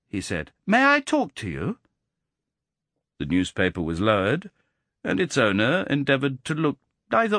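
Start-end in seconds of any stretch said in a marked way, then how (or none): 0.7-1.8 put-on a clever boy speaking up neatly; precise boy's treble. A narrator's reading. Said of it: "John's curiously precise treble".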